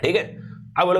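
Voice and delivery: usual speaking voice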